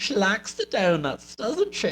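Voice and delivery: put-on high, slow Southern drawl of an old American lady; mock Southern American accent, pitched high. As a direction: ham up a Southern old lady's accent, slowly. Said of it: "like, Southern American accent?".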